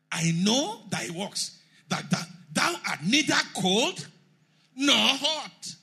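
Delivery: slow, rising, forceful verse reading; loud scripture reading. Real, not acted. Preacher booming scripture to the congregation, forceful. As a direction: read the verse out like a herald.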